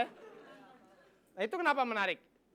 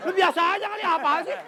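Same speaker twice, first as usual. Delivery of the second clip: loud, high, laughing comeback; loud laughing mock protest; laughing retort; 8 semitones higher, 11 dB louder than usual. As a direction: snap back at the dramatic mom through laughter.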